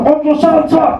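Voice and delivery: put-on high garbled old man, like German; mock scolding elder, words unclear; grumpy old man gibberish. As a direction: mumble the words so kids can't follow. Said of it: "dia akan cakap Jerman".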